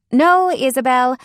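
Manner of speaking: ordinary delivery, nothing marked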